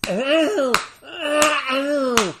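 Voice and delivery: high, loud faked cries of pain; hammed-up ow ow ow; mock yelps of pain. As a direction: yelp as if being smacked. Slapping leg, faking pain.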